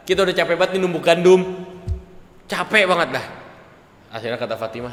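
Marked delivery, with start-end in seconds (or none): 0.1-3.3 tired complaint. Retold or imitated. Complaining of tiring pounding.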